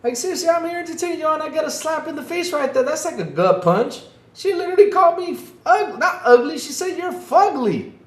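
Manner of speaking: high, fast indignant complaint; hammed-up offended rant; playful wounded protest; mock outrage.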